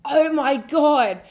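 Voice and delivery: loud, high, drawn-out gush; gushing excitement. Real, not acted. Telling friends how cool Universal was, gushing. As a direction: stretch it out, overwhelmed with excitement. Gushing repeat, much louder and slower.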